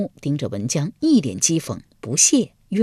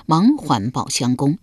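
ordinary delivery, nothing marked

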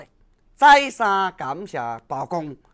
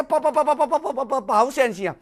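fast stuttering plea, close to crying; terrified official begging Bao Gong, stuttering on the edge of tears; stammering plea near tears; 5 semitones higher, faster than usual. Acted. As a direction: stammer the name in panic, almost sobbing. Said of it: "规个人计要哭出来".

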